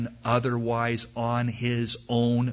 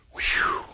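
whispered whew of relief; relieved whew. Acted. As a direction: blow out the relief. The eaglet sighs in relief.

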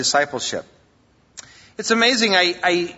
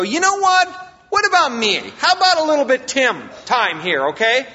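much higher, louder whiny protest; mock self-pity; 9 semitones higher, 3 dB louder than usual. Pastor imagining Joseph griping about his prison years, higher whiny protest. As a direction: play up a hard-done-by complaint. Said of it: "if anybody ever had a reason to go".

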